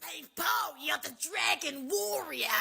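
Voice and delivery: high mock shout; hammed-up, high-pitched cry; grating character imitation. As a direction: mimic an ear-assaulting cartoon sidekick. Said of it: "oh, my eardrums were accosted".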